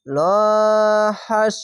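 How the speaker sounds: লহাস chanted with a long, thick first vowel; slow recitation of লহাস, first syllable held; stretched, heavy vowel. Shown as a demonstration. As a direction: draw out the "lo" of লহাস. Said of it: "এক আলিফ টেনে পড়তে হয়".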